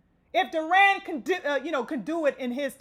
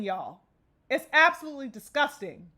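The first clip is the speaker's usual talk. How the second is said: ordinary talk, nothing marked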